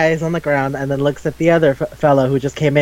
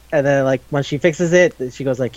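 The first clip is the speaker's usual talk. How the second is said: usual speaking voice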